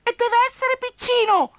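very high tiny squeak; squeaky mouse. Acted. Mouse squeaks that man must be small. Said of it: "squittì Topo".

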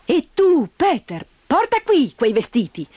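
high, brisk ordering voice; aunt Dete ordering Peter about; bossy aunt. Acted. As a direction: give orders to the goatherd boy.

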